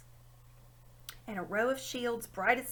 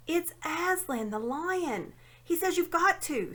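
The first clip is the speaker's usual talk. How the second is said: higher, louder and pleading; despairing; 7 semitones higher, 4 dB louder than usual. A narrator's reading. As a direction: push it like a desperate plea he won't hear. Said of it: "said Jill despairingly".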